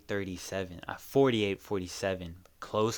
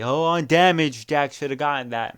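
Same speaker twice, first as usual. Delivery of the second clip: doubting fan; 6 semitones higher, 9 dB louder than usual. Retold or imitated. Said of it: "People might say".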